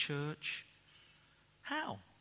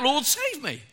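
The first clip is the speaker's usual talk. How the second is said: cry for help; 11 semitones higher, 8 dB louder than usual. Retold or imitated. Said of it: "cried out".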